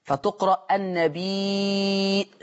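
فتقرأ النبي slow, with a hamza and a stretched ي; Warsh reading with hamza. Shown as a demonstration. Teacher demonstrating the Warsh reading to students. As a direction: recite النبي as النبيء with a long madd. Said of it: "فإذا قرأ النبي بالهمزة".

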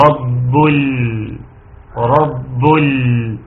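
very slow «رب ال» with the ل held clearly; clearly sounded lam. Shown as a demonstration. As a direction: draw out «رب ال» and let the ل sound fully. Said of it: "حكمها الإظهار". (إظهار اللام في «رب ال» ببطء)

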